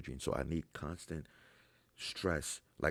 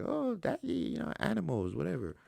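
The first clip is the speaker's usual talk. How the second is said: put-on much higher little kid voice; imitating small child; 14 semitones higher than usual. His kid chattering to daddy, higher childlike voice. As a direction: chirp it like his toddler.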